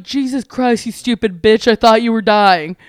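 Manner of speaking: loud, annoyed scolding; exasperated roommate. Retold or imitated. Roommate to her after thinking she was dying, loud exasperation. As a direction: snap at her in relief and irritation.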